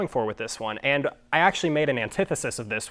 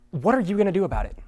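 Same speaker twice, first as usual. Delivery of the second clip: mock challenge; 7 semitones higher, 3 dB louder, faster than usual. Challenging the opponent in a mock debate.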